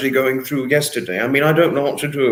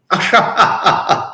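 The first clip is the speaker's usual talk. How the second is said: high loud laugh; burst of laughter; 5 semitones higher, 4 dB louder than usual. Real, not acted. Streamer laughing at his own swearing.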